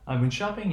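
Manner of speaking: "shopping" high and questioning; quick high question ending on "shopping"; asking to validate. Shown as a demonstration. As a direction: pitch "shopping" up, waiting for the answer. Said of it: "the speaker is sort of asking".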